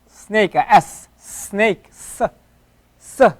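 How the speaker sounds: "snake" slowly, then a drawn-out hissing "s"; slow demo of the Arabic sin as an s; hissed s. Shown as a demonstration. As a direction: hiss the s like a snake. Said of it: "that's the S sound".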